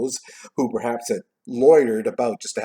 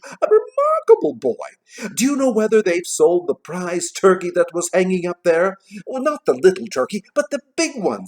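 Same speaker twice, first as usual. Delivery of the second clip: put-on high, loud, excited old Scrooge; delighted Scrooge; 9 semitones higher, 7 dB louder than usual. A narrator's reading. Scrooge asking about the turkey, delighted and eager. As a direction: exclaim and question in eager high bursts.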